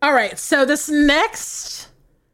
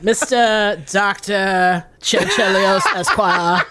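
put-on slow, grand old-Hollywood drawl; a slow mock-suave movie-star delivery; Cary Grant voice; 3 semitones lower, slower than usual. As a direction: announce the name like Cary Grant. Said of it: "in a Cary Grant voice".

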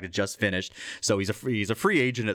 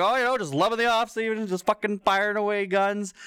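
wasted pitcher; 11 semitones higher than usual. Retold or imitated. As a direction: play the drunk guy bragging. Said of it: "He's just like".

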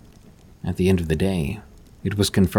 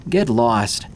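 yelling; 3 semitones higher than usual. A narrator's reading. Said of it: "but Jessica yelled".

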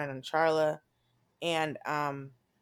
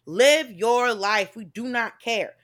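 loud joke delivery; 6 semitones higher, 7 dB louder than usual. Retold or imitated. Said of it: "I was like".

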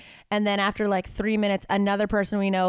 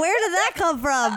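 surprised; 9 semitones higher than usual. Retold or imitated. Retelling a surprising joke.

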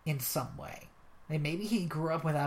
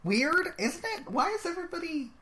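high, incredulous put-on voice; exasperated movie fan ranting about English actors; mock disbelief; 9 semitones higher than usual. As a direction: play up baffled outrage in a much higher voice. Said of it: "I was like".

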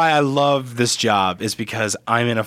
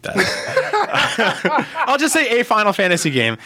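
laughing; 10 semitones higher, slower than usual. Real, not acted. Laughing at own slip.

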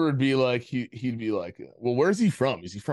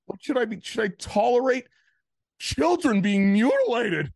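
mock Jordan Peterson; 9 semitones higher, 5 dB louder than usual. Friend mocks Jordan Peterson starting to cry, high loud imitation. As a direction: ham up his tearful outrage. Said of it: "And he's just like".

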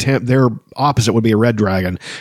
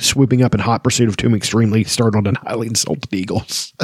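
ordinary talk

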